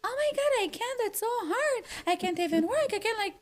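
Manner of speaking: high, far whiny wail of self-pity; mock despairing whine, pitched high; victimized whining. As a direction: whine like the world is ending. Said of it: "Like, I'm victimized".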